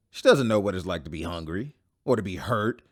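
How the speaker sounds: ordinary talk, nothing marked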